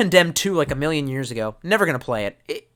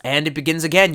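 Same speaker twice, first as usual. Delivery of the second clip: ordinary delivery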